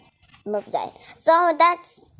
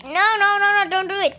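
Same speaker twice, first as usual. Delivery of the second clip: higher, louder no, no, don't do it; pleading; 6 semitones higher, 4 dB louder, faster than usual. Real, not acted. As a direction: beg urgently. Begging troll to stop.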